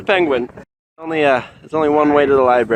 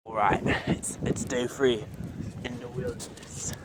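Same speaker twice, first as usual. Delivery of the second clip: whispered, low survival-show voice; hushed documentary narration; 7 semitones lower, 14 dB quieter than usual. Acted. Mock survival documentary intro.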